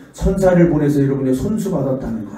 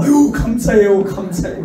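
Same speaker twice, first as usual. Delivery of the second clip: grateful mother; 5 semitones higher than usual. Retold or imitated. Mother thanking the preacher for the money, high and grateful. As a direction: thank him gushingly then trail off. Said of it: "그 엄마가".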